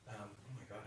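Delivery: ordinary talk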